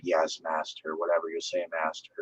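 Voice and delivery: hushed, low, broken servant; submissive quiet voice. Pretend. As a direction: murmur it like a broken slave. Broken torture victim obeying master.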